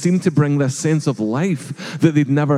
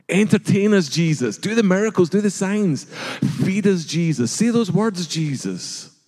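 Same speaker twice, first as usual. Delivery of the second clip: higher, insistent crowd taunts; demanding crowd; 7 semitones higher than usual. Acted. Crowd taunting Jesus for miracles, high and insistent. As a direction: pitch up and demand a show.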